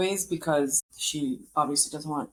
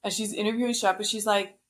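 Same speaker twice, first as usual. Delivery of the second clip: ordinary talk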